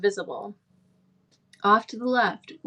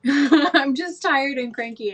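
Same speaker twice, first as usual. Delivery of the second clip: laughing, a bit higher; laughing admission; 5 semitones higher, 5 dB louder, slower than usual. Real, not acted. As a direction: laugh it out, self-mocking. Laughs at her own moods.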